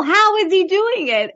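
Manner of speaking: animated mock disbelief; amazed disbelief. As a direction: marvel at the crazy setup. Retelling her reaction watching videos.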